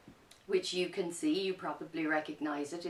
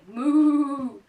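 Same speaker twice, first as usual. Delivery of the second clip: loud, higher moo; 8 semitones higher, 12 dB louder than usual. Acted. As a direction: chase him off mooing. Animals chase farmer away.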